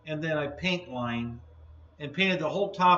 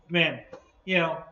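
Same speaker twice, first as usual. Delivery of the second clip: usual speaking voice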